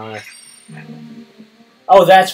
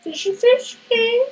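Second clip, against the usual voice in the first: squeaky, slow sing-song call; very high sing-song; slower than usual. Acted. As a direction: stretch it high and slow to lure the fish. Coaxing the fish, drawn out.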